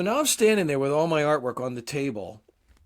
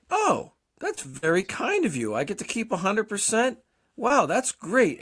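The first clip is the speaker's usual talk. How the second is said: naive delight; 7 semitones higher, slower than usual. Retold or imitated. Retold delight at keeping money.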